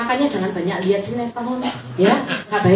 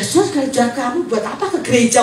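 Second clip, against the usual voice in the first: ordinary talk, nothing marked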